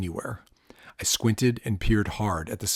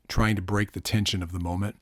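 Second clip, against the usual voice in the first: ordinary delivery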